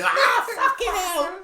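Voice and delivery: a sudden laughing shout; startled driver swearing, loud and laughing; mock startled yell. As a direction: blast it into the mic as if the driver jumped. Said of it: "I've just done it again".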